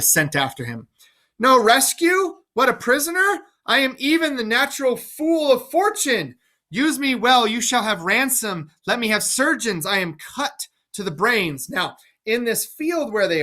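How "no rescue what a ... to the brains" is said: put-on much higher, pleading mad old king; a cornered king talking fast to his captors; frantic mad king. Lear caught in the field, higher, frantic pleading.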